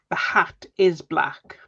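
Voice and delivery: ordinary talk, nothing marked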